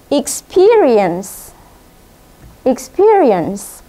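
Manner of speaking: Experience stretched out slowly as ik-SPI-ri-ens; slow model pronunciation. Shown as a demonstration. Teacher showing pupils how to say it, slow and clear. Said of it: "bagaimana cara melafalkannya".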